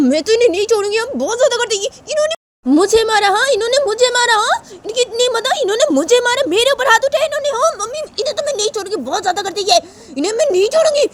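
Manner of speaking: put-on very high, loud outraged girl; furious girl. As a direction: vow you won't let him go. Uma furious at Sanju. (बहुत ऊँची गुस्से भरी लड़की)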